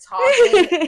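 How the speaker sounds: high loud stretched yawn-word; yawning mock. Mocking someone who talks while yawning, loud yawn. Laughing imitation of yawn-talk.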